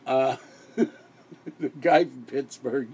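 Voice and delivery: slow, laughing through the words; maniacal laughing. Real, not acted. Host signing off, laughing maniacally at himself. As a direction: cackle as you say it. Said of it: "with the maniacal laughing".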